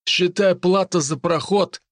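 grin and demand payment brazenly; russian street thug. Acted. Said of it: "нагло залыбился парень".

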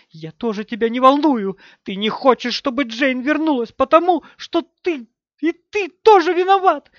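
high, loud, furious tirade; shrieked accusations. A narrator's reading. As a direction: hurl accusations at a husband, surging into screeches. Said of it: "завизжала она". (яростные визгливые обвинения)

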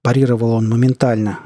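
ordinary delivery

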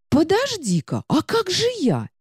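high, squeaky indignant book; a fussy little magic book protesting; talking book voice. Acted.

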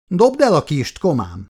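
high, loud order; shouted command. A narrator's reading. Chris orders the wounded marine, shouting high and loud. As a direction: yell it at the attacker. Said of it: "kiáltotta".

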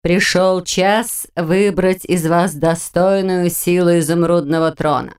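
slow, majestic command; imperious plummy grande dame. Acted. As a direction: summon them like a stately queen. Same witch continues majestically.

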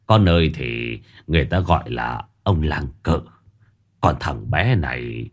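trầm và nhỏ, giọng ông già chậm rãi; hạ giọng xuống như cụ già điềm đạm; giọng ông lão. Acted. Ông cụ tiếp tục nói. (cụ già giới thiệu tên với Dần, giọng trầm chậm)

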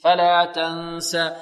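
فلا تنسى with the nun hidden in a long nasal hum; ikhfa with nasal ghunna. Shown as a demonstration. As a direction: hold the ghunna on تنسى, slow. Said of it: "سين". (فلا تنسى بغنة كاملة وإخفاء)

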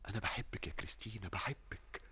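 soft, breathy confession of love; tender whisper. Acted. Suitor confesses love softly.

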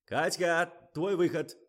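high, loud cue; calling out. A narrator's reading. Director cues Katya to come on, shouting. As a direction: shout the model onto the set. Director calls model on set.

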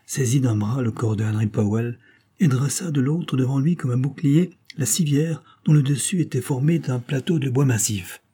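usual speaking voice

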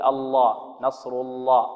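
الله with a thin lam; weak lam. Retold or imitated. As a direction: imitate the wrong light lam in الله. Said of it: "ولا نقول". (خطأ ترقيق اللام في الله)

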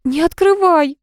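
high, wailing plea; acted tearful protest. A narrator's reading. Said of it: "заплакала Галя".

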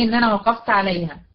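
ordinary talk

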